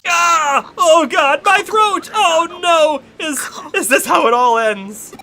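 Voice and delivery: shrill choking dying yell; hammy death scream, clutching the throat; death cry. Acted. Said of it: "Arata's death cry".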